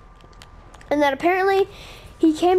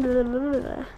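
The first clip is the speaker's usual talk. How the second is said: ordinary delivery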